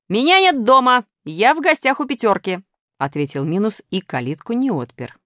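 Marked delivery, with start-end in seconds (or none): 0.1-2.6 high, loud call through the gate. A narrator's reading. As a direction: shout back to those outside. Calling back through a closed gate.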